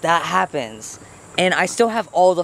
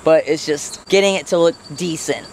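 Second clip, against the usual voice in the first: ordinary talk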